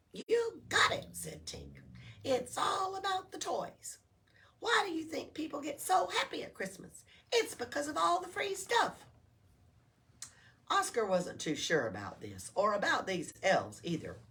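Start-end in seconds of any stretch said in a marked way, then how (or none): 0.1-9.1 high, chirpy little elf voice; cheerful elf. Acted. Elf explaining to Oscar, high and bouncy. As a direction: introduce yourself like a perky elf.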